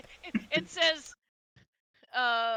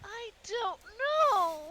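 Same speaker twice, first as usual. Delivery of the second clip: high, very drawn-out puzzlement; bewildered; 7 semitones higher, much slower than usual. Acted. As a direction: stretch each word in mock bewilderment. Bewildered character answers.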